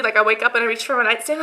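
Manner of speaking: ordinary talk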